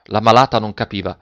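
usual speaking voice

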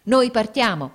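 loud, high impatient warning; grido imperioso, impaziente. A narrator's reading. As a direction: shout that time is up. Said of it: "gridarono in coro i marinai con imperiosa impazienza". (grido forte e impaziente)

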